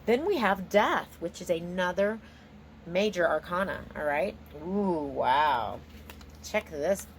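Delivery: ordinary talk, nothing marked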